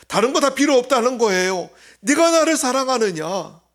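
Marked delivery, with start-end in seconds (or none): 2.0-3.6 "네가 나를 사랑하느냐?" called out higher and louder, stress on 네가; emphatic question. Acted. Preacher repeating Jesus's question to the congregation, loud and pressing. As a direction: press the question home, ringing out.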